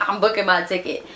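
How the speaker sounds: ordinary talk, nothing marked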